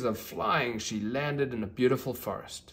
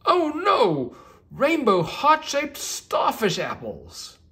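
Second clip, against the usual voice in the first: much higher, loud oh no then slow list; a unicorn horrified by her muddled magic; squealing oh no, then spelling out the mess; dismayed surprise; 9 dB louder, slower than usual. Acted. Magic goes wrong, unicorn dismayed.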